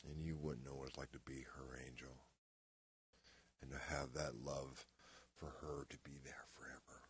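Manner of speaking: soft, subdued, falling to near whisper; quiet intimacy, fading out; tender recollection. Acted. Acted monologue, recalling devoted love.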